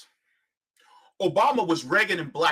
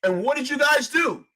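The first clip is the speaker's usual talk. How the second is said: accusing shout; 8 semitones higher than usual. Real, not acted. Accusing the audience.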